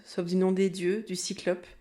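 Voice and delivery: ordinary talk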